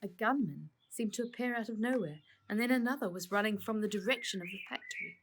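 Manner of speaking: ordinary delivery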